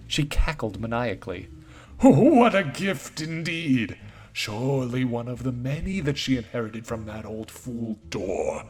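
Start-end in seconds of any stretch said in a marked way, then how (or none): 2.0-8.6 mocking corrupted voice. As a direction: gloat like a spirit that knows too much. Corrupted voice mocks after cackling.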